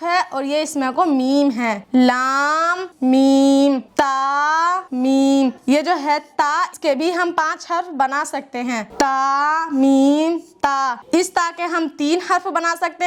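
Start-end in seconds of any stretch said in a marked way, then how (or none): 2.0-5.5 slow letter naming. Shown as a demonstration. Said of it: "teacher reads letter names slowly". 8.9-11.0 slow letter naming. Shown as a demonstration. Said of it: "teacher reads letter names slowly".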